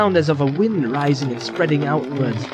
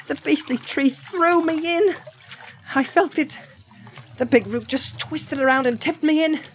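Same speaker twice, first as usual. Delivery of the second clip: much higher hobbit voice; indignant Frodo; 12 semitones higher than usual. Acted. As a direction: tell your friend of the tree's attack in a high voice. Frodo tells Sam what happened.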